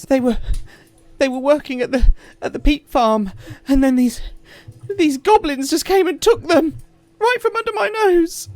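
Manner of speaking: high, loud, stammering plea; a panicked mother begging for help; frantic farmer woman. Acted. Distraught farmer woman begs the party, stammering high and loud.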